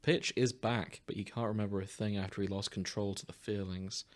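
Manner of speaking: ordinary talk, nothing marked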